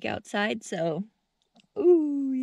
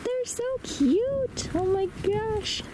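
very high, drawn-out adoring coo; cutesy gushing; 11 semitones higher, slower than usual. Real, not acted. Gushing over cute flowers.

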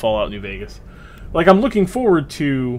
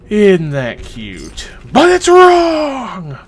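slow sweet question snapping into a loud high shout; mock outrage; 7 semitones higher, 9 dB louder, much slower than usual. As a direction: coo it slowly, then explode in mock outrage. Mock cooing turns to outrage.

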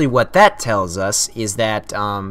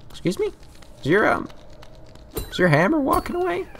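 much higher, incredulous questions; baffled; 5 dB quieter, faster than usual. Real, not acted. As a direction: ask it in disbelief. Baffled at fleeing enemy.

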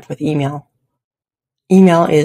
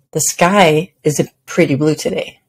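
emphasize the word "sky" in the sentence; stress on sky. Shown as a demonstration. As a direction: lean on "sky", then a slight break. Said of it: "with emphasis on a different word each time".

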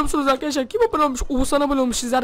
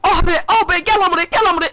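pained shouting; 5 semitones higher, 5 dB louder than usual. Real, not acted. Darbe yerken bağırıyor.

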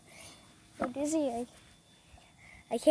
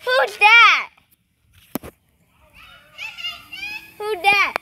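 calling out; 11 semitones higher, 6 dB louder, slower than usual. Real, not acted. Calling to people nearby.

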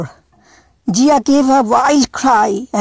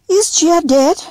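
put-on little girl asking; 9 semitones higher than usual. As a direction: ask like a worried small child. Little girl Setsu voiced.

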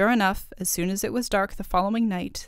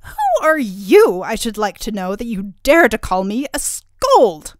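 angry; 3 semitones higher, 10 dB louder than usual. A narrator's reading. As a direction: berate him as an insulted princess. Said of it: "cried Turritella angrily".

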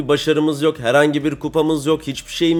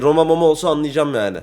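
ordinary talk, nothing marked

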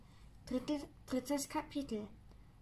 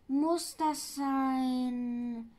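lightly voiced Sams; 4 dB louder, much slower than usual. Sams speaks, voice put on.

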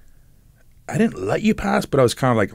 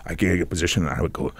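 ordinary talk

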